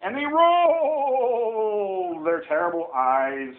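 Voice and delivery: "rolled" held very long, falling; drawn-out dramatic reading. A narrator's reading. Parent reading monsters to a child, "rolled" dramatically stretched.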